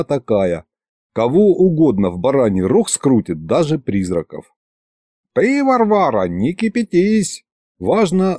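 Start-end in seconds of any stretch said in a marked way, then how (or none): 5.3-7.4 high, pompous placating; self-important, slightly ingratiating. A narrator's reading. Old sorcerer soothing an angry woman, slightly ingratiating. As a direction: placate her with importance and a little fawning. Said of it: "несколько заискивающе начал Лиховид".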